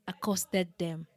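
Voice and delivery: plain reading voice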